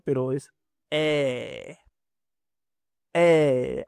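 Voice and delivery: "E" slow with an open mouth; open e demo. Shown as a demonstration. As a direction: open wide on "E". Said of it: "casi como la E en español". ("E" abierta, despacio)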